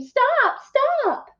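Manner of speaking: high desperate cry of "stop"; screaming stop. Acted. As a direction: yell after the runaway cookie in a high voice. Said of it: "The little old woman screamed".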